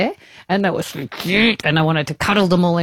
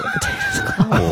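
laughing exclaim. Real, not acted.

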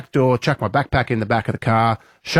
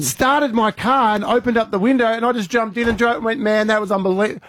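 much higher, breathless amazement; amazed; 10 semitones higher than usual. Retold or imitated. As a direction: gush like it was pure magic. Amazed retelling of the magic unlock.

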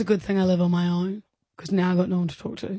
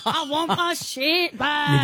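slow, drawn-out Scottish-tinged complaint; slipping Scottish accent; 6 semitones higher, slower than usual. Acted. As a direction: drag out the words like a grumpy Scot. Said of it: "You're going Scottish".

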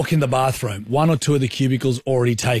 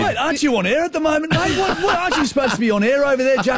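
much higher, fast incredulous voice; mock incredulous guy; 13 semitones higher, faster than usual. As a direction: play the surprised colleague, pitched way up.

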